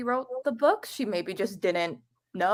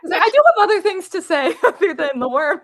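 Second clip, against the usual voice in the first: laughing; 9 semitones higher, 9 dB louder than usual. Real, not acted. Laughing about the worm topic.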